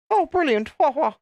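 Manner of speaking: mocking posh English babble, very high; mock posh English accent. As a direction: squeal it like a posh English toff. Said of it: "Had that kind of accent".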